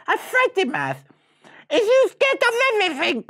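high, fast, loud question; squeaky street-slang challenge; taunting brother. Acted. Mouse brother asking Freddy about his fears, taunting.

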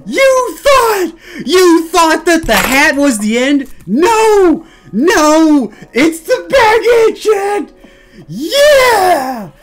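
loud, high, drawn-out triumphant rant; hyped shouting. Acted. Streamer revealing the baguette to chat, hyped shouting. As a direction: shout it like a grand showman's reveal. Mock-defiant hype speech to chat.

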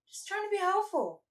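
high-pitched, defensive in-character plea; playful protest. Acted. Character tells a teasing companion he only meant to help, high protest.

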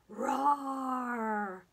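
long pretend roar; dinosaur roar. Teacher roaring along in the children's rhyme, long dinosaur roar. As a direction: draw out a playful dinosaur roar. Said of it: "Hear me roar".

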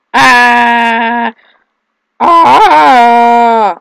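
loud, drawn-out wordless screams; long screams. Real, not acted. Player falling in the game, long screams. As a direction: hold the yell out long.

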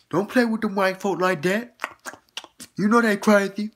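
hammed-up high warning; mock yell. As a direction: warn her loudly from afar. Continued yelling warning.

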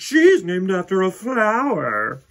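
put-on high, loud snooty girl; boastful girl. A narrator's reading. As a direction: brag to the whole class. Victoria mocks.

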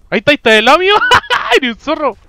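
loud gleeful yelling; excited shouting. Real, not acted. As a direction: shout with a laugh. Spotting friend in game, laughing.

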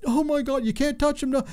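high, alarmed cry of panic; a nurse shrieking to stop him; panicked nurse. Retold or imitated. Nurse bursts in on son holding father's hand, panicked high cry. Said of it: "she's freaking out".

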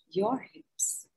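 your hips breathed out in a whisper. Shown as a demonstration. Guiding the group through the decree, whispered. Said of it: "You can whisper it".

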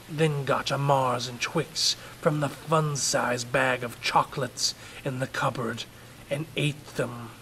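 ordinary delivery, nothing marked

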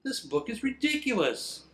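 exasperated. Real, not acted. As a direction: complain in disbelief. Reader exasperated.

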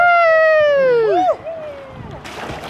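long, high "woohoo"; elated, falling whoop; cheering whoop. Real, not acted. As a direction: cheer the jump with a drawn-out holler. Cheering as partner jumps in.

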